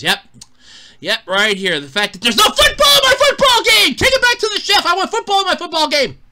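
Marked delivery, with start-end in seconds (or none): 1.8-6.2 furious shout. Real, not acted. Complaining to the chef about no football, an angry yell. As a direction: demand your football back.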